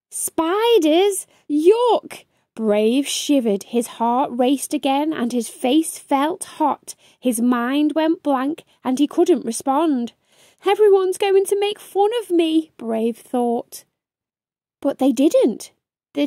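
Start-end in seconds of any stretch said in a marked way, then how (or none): 0.1-2.2 high, rising yuck of disgust; disgusted little beaver. Acted. Asked about spiders, Brave squeals in high disgust. 10.6-12.7 high, fast panicked thought; panicked little beaver. Acted. Brave freezes in class, high fast panic.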